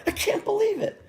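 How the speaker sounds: put-on high-pitched kid's disbelief; retold disbelief. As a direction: squeal it in mock amazement. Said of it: "Just like that".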